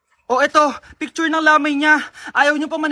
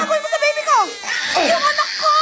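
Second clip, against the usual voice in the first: frantic scream; 11 semitones higher, faster than usual. Acted. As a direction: scream for the baby in panic. Mother screams about her baby.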